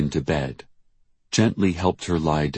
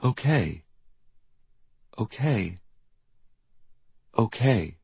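high, small okays with falling ends; suppressed panic; 6 semitones higher than usual. A narrator's reading. Diane hiding her fear from Jackie, suppressed panic. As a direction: say each one apart, holding panic in. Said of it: "trying to keep her panic from showing".

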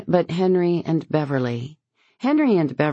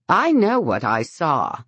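with conviction. A narrator's reading. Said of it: "says with conviction".

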